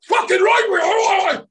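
high, loud excited whoop; excited cheer. Retold or imitated. Tank crew cheering as filming starts, excited. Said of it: "they went".

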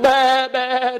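high, frightened little bleat; scared lamb bleat. Acted. Preacher voicing the shocked lamb, high frightened bleat.

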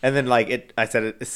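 ordinary talk

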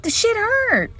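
high squeal of pain. Real, not acted. Pulling dog hair from toe.

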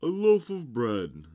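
high, loud, hearty announcement; pompous walrus. A narrator's reading. Walrus announces the meal, loud pompous voice.

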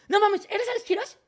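excited fans. Retold or imitated. Excited fans recognize him.

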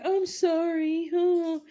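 slow, drawn-out mock apology in a put-on voice; mocking her apology. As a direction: mimic her fake sorry, dragging it out. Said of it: "And she's like".

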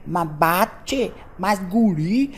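mock gaúcho accent, lightly done; a light imitation of a southern Brazilian accent. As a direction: tease the southern Brazilian way of talking. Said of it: "Sabe, fala".

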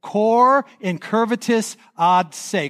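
slow Cor incurvatus ad se, every syllable clear; Latin phrase demo. Shown as a demonstration. Pastor leading the congregation in Latin, slow and clear. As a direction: lead the room through Cor incurvatus ad se. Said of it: "Let's say it together".

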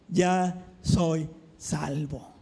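"ya soy salvo" drawn out low and slow; tender, slow confession. Retold or imitated. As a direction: breathe out "ya soy salvo" gently. Retold joyful announcement. (confesión lenta y tierna)

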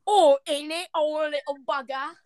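mock British exclaiming; exaggerated British accent. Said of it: "Do a British accent".

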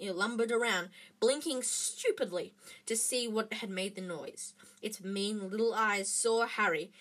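ordinary delivery

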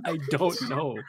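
helpless real laughter through the words; laughing. Real, not acted. Storyteller answers why a cashier brought a peso, laughing. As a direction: answer while cracking up. Cracks up at friend's question.

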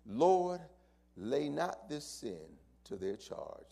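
reverent scripture reading; preaching. Acted. Preacher reading the dying words to the congregation, reverent. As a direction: read it slow and reverent.

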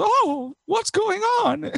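very high-pitched imitation old lady; old lady churchgoer. Old lady churchgoer calling to her priest, high imitated voice. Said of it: "like, an old lady".

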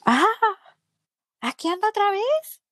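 put-on high, surprised voice of the young nurse; nurse recognizing her. Nurse spots her back in the line, high friendly surprise. Nurse greets returning patient.

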